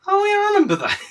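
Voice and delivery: very high, loud mock realisation; exaggerated high-pitched impression of mum; mum's sudden recognition. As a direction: squeal it as mum suddenly remembering. Mimics mum suddenly remembering.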